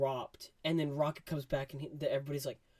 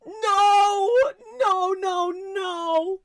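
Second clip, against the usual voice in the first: much higher, loud, crying no's; distraught denial; 13 dB louder, much slower than usual. Retold or imitated. Vlogger reliving Rocket's death, crying out. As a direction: wail it like heartbreak. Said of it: "I was like".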